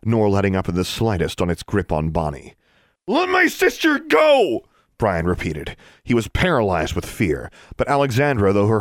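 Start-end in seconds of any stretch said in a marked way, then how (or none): 3.1-4.7 high, loud desperate yell. Acted. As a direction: shout the demand, voice straining. Boy yells at monster.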